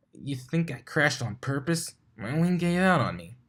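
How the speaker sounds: a hint of a tomboy pony, defensive; lightly voiced Dash. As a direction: shrug it off, mildly indignant.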